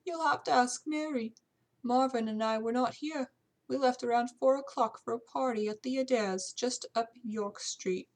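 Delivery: amateur British servant voice; British housekeeper. Acted. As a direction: voice a servant speaking up about her household.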